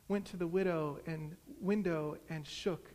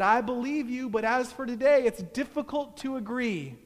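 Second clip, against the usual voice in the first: raised, higher-pitched exasperation; annoyed retort; 6 semitones higher, 5 dB louder than usual. A narrator's reading. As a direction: argue back in irritation. Annoyed adversary keeps arguing in story.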